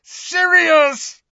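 high, loud, drawn-out cry of a name; roar. A narrator's reading. Harry calling desperately for Sirius, roaring. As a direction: roar for someone who won't come. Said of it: "roared Harry".

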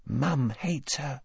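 soft, slow aside; quiet. Acted. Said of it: "said Ginny quietly".

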